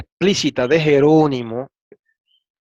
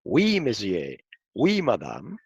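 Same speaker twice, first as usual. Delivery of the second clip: softly spoken French phrases; quiet French demonstration; 5 semitones lower, 6 dB quieter, slower than usual. Shown as a demonstration. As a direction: say it in French, low and light. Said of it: "cuando se dice sí en, en francés".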